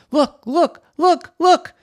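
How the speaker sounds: high, loud, urgent shouts; coach shouting. Acted. Coach shouts to a player on court, urgent shouting. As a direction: shout it in quick bursts. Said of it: "her coach started shouting".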